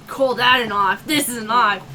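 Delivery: laughing, hammed-up Aussie movie line; a deliberately bad Crocodile Dundee impression; bad Australian accent. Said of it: "doing a really bad Australian accent".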